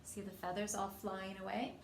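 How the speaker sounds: ordinary delivery, nothing marked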